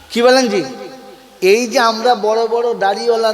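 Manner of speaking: loud, rousing call to the crowd; forceful sermon shout; loud preaching. Real, not acted. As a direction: rise up and drive the point home.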